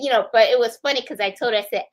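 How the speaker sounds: ordinary talk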